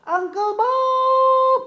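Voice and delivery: high, slow, sung-out call. Acted. Puppet Kobon calls out to Uncle Bob, high and drawn out. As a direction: stretch the name out like a call across a room.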